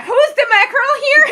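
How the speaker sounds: high, loud comic outrage cracking into laughter; acted offended shriek, laughing; mock crazed outburst. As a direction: explode in hammed-up indignation. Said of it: "Aizuki goes crazy".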